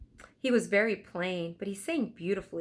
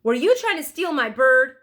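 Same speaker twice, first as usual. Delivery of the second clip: loud, higher accusing voice; angry accusation; 7 semitones higher, 12 dB louder than usual. Acted. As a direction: demand an answer. Angry seller confronts children.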